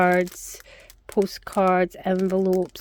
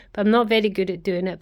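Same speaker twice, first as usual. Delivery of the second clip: ordinary talk, nothing marked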